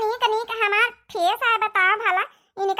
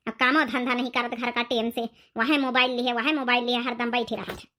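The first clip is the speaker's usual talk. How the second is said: grumbling grandmother; 9 semitones lower than usual. Acted. Grandmother complains about daughter-in-law.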